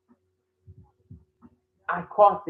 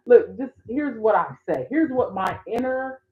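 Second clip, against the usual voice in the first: ordinary delivery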